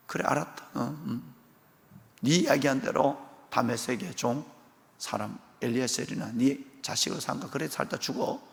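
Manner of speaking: hushed, icy verdict; God quietly giving up on Abraham; cold dismissal. Acted.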